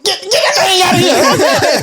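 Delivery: high, slow laughing mock yell; hammed-up mother shooing it away; mom shouting. Mama yelling at the kid to take the dead hamster out, mock yell.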